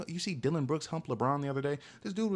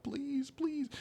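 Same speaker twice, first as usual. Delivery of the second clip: begging; 4 dB quieter than usual. Pretend. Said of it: "panhandle to you real quick".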